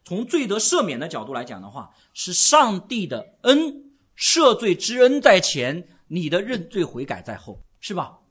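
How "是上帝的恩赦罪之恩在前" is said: slow, loud, high, every word hammered; emphatic preaching. Real, not acted. Preacher to congregation, hammering the point slowly and loudly. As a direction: drive home the grace coming first. Preacher stresses grace comes first.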